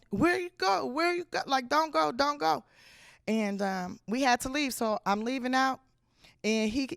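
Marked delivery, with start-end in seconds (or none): 0.1-2.6 higher-pitched, lightly voiced small child; little brother pleading. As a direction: imitate a little boy begging someone to stay. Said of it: "And he's like".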